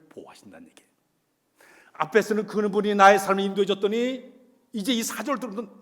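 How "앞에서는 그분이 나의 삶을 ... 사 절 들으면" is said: booming, emphatic sermon climax; loud preaching. Acted. 설교 절정에서 회중에게 힘차게 외치듯. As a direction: drive the point home to the congregation.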